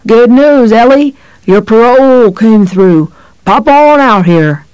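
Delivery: loud, slow, mock-cheerful gloat; sweetly menacing taunt; taunting jovial. A narrator's reading. Gloating monster cop taunts prisoner.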